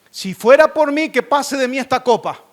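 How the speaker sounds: loud, high plea; acted pleading prayer. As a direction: cry out to God in acted prayer. Acts Jesus's prayer of pleading.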